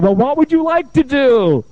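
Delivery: high, loud, drawn-out stock question; exaggerated therapist question. Therapist to spent client, exaggerated loud question.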